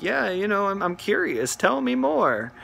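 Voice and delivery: mock-eager curiosity; egging him on. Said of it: "egging him on".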